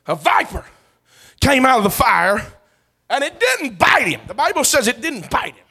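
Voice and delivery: high, loud, fervent preacher's cry; loud revival preaching; preaching at full force. Acted. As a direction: preach it out to the congregation. Preaching the viper story.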